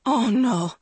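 shocked realisation; gasping. A narrator's reading. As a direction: gasp it in horror. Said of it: "Maggie gasped".

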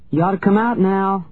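calling aloud. A narrator's reading. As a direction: call out for someone hiding. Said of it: "I said aloud".

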